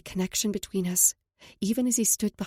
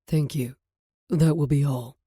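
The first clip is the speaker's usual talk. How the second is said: lower, tender male voice; man's voice; 4 semitones lower, 5 dB louder than usual. Acted. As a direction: speak as a calm man. Knox dismisses waiter.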